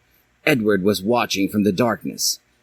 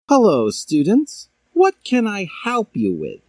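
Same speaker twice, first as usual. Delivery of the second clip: higher, put-on professor voice; skeleton teacher; 11 semitones higher than usual. A narrator's reading. Jack Skeleton greeting students, higher put-on voice. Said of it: "he asserted".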